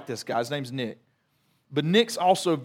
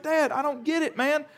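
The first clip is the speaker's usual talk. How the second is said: put-on much higher, casual teen voice; imitating his son; 10 semitones higher than usual. Son shrugging off his dad, imitated teen voice. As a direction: play the laid-back kid. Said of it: "this is how he talks to me".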